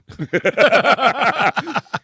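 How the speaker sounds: a loud, high burst of real laughter. Real, not acted. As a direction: break up laughing mid-sentence. Bursts out laughing mid-speech.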